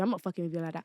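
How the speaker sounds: ordinary talk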